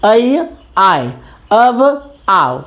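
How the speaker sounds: ऐ आय, अव आओ stretched out very slowly; slow sound demo. Shown as a demonstration. Teacher drills the sound changes ऐ आय, अव आओ very slowly. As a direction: pronounce ऐ आय, अव आओ slowly and deliberately. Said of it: "नाम में ही है अयादि".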